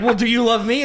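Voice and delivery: put-on pleading girlfriend. Girlfriend's question acted out.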